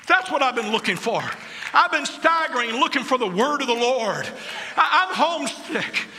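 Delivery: high, loud revival preaching; fiery preaching. Real, not acted. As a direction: preach it out at full force. Preaching at full force.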